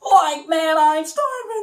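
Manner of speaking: high, slow, whiny hungry Shaggy. Shown as a demonstration. Showing viewers Shaggy complaining he is starving. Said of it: "that's how I do the voice of Shaggy".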